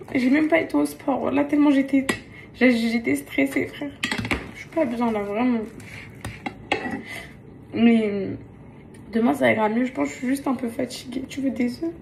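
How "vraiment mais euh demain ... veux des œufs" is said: normal talking voice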